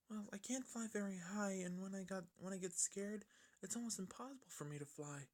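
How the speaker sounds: high, soft timid pony voice; soft shy high-pitched filly; Fluttershy voice. Acted.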